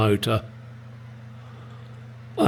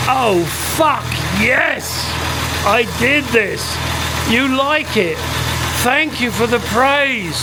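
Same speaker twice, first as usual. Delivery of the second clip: higher, delighted and smug; gleeful inner voice; 9 semitones higher than usual. Retold or imitated. Telling viewers what the English really think, higher gleeful delight. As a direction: crow inwardly over the praise. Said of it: "When inside we're going".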